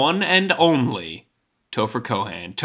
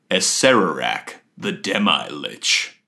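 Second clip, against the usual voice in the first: deep, slow dramatic introduction; grand announcer intro; 7 semitones lower than usual. Acted. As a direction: announce him like a ring-side herald. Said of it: "here to tell us ... the one and only".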